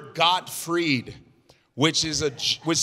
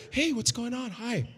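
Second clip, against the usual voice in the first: higher, much softer, meek greeting; timid Gilbert offstage; 6 semitones higher, 9 dB quieter than usual. Retold or imitated. Comic showing how gentle Gilbert talked offstage, soft and higher. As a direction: say hi shyly like a quiet man. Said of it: "he talked like this".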